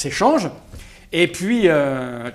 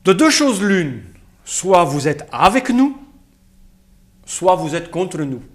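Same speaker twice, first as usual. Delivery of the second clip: put-on Russian accent; 4 dB quieter than usual. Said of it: "Avec un accent russe".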